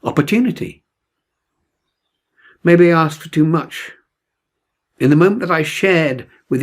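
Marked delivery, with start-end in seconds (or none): none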